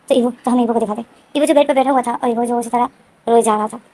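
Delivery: plain reading voice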